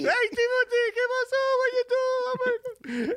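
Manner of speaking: piercing falsetto auntie impression; mock high-pitched relatives; Cuban aunts and uncles. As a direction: call out high like a fussing Cuban aunt, stretching the last words. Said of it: "all the Cuban aunts and uncles".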